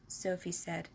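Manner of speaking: ordinary delivery, nothing marked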